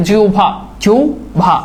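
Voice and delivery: জিহ্বা said slowly, হ্ব sounding as ও-ভ, like জিওভা; slow careful pronunciation. Shown as a demonstration. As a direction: spell out জিহ্বা with a ওভ sound, unhurried. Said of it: "এখানে ও ভ আছে".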